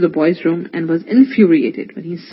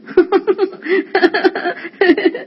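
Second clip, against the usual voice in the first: put-on high, bubbly kids' laughter; children's giggling; 12 semitones higher than usual. The boys being tickled awake by Irfan, giggling.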